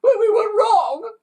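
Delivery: very high, loud, slow crying wail; child yowling in tears. Acted. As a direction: wail it through sobs. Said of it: "yowled a fifth".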